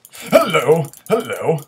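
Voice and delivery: high, loud mock-cheery hello; sing-song battle hello; loud greeting. Real, not acted. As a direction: yell a taunting hello at the enemy. Greeting enemy loudly in fight.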